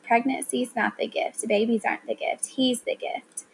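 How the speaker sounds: usual speaking voice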